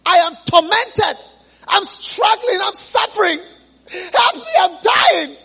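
a damned man shouting for mercy; pleading shout. Acted. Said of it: "he shouted and he said".

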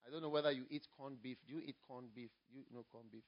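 ordinary talk, nothing marked

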